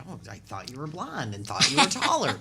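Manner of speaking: normal talking voice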